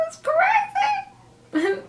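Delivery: very high, stretched-out squeal; playful high-pitched exclamation; amazed squeal. Real, not acted. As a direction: drag it out way up high in disbelief. Sign-off amazement at the episode.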